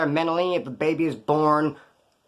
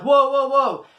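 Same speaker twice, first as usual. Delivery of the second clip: higher, louder startled backing off; mock startled; 9 semitones higher, 6 dB louder, much slower than usual. Guys backing off from the fake gun, startled. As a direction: hands up, whoa, whoa.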